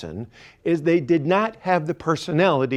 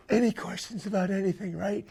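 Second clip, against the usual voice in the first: hushed; 8 dB quieter, faster than usual. Retold or imitated. Secret question to doctor.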